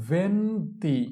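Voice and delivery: slow, held "venti" for learners to copy; drawn-out model. Shown as a demonstration. Teacher models venti for learners, drawn out. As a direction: stretch "venti" into two long syllables.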